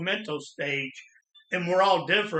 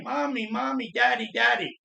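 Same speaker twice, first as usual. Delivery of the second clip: higher-pitched, restrained imitation of screaming; retold child's screaming; 5 semitones higher than usual. Child in pajamas calling to fighting parents, mock scream held back. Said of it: "just screaming".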